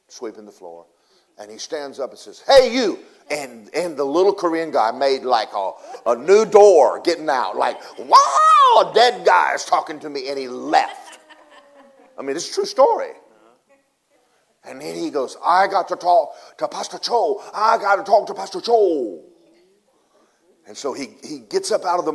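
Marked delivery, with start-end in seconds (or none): 2.5-3.0 sudden high, loud hail; calling out. Retold or imitated. Revived man calling to the morgue sweeper, shouting. 8.0-10.3 hammed-up high scream of alarm; shriek, then blurt it loudly; panicked shriek. Korean worker fleeing the talking dead man, mock-terrified yell. 15.4-19.2 high, loud, insistent retold cry; urgent demand. Revived man demanding his pastor, urgent shout.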